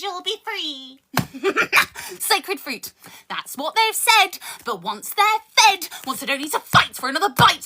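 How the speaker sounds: rhythmic poetry recital with dark punchlines; spoken word poem. Acted. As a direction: perform it like a slam poem. Performs the cat poem.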